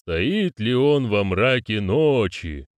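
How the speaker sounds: very slow, solemn ceremonial question; drawn-out commanding challenge; demanding ritual voice. Acted. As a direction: demand it slowly, stretching each word. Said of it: "требовательно спросил голос".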